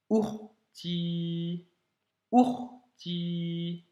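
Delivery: "ourti": hold "ou", block it, then "rti"; Arabic sukun stop. Shown as a demonstration. Teacher repeats "ourti" slowly for the student to copy, stop after the ou. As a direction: say "ourti" with a clean stop after "ou", no vowel before the r. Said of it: "blocage du ou".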